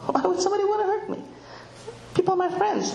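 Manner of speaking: put-on high, quick, puzzled child voice; trusting child. Trusting child warned about strangers, puzzled high voice. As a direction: imitate a baffled, innocent child.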